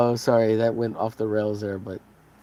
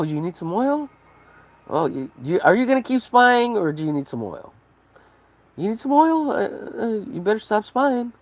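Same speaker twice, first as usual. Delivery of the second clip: high, mocking salesman voice; smug seller; 7 semitones higher than usual. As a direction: taunt China like a smug shopkeeper. Mock seller taunting China.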